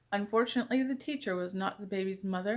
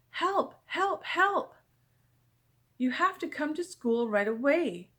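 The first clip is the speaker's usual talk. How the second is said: high urgent cries for help; panicked call; 6 semitones higher, slower than usual. Acted. Boy phones his mother in a panic for help. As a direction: plead for help in a rush.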